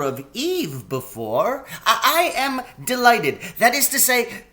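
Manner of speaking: a polite, flustered faun covering a slip; high eager faun babbling; excitable faun. Acted. Faun character voice.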